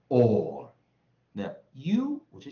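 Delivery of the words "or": "or" held long and loud into a curled R; American R-coloured vowel. Shown as a demonstration. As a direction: model "or" with the R clearly sounded. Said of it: "我就念or". (美式 "or"，响亮拉长卷舌)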